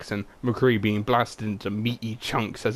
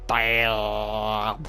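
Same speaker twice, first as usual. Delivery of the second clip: one flat blunt burst; Brigitte impression. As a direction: announce the entrance deadpan. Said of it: "my Brigitte impression".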